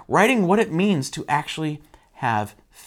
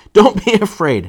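loud, raised command; acted shout of reassurance; calling out; 5 semitones higher, 8 dB louder than usual. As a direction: call it firmly to frightened friends. Repeats Jesus' call to disciples.

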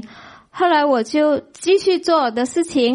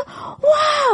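high cry of awe, half shout; overwhelmed cry; 13 semitones higher than usual. Retold or imitated. Believer overwhelmed as the Lord answers yes, crying out high. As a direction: let out a high wondering wail.

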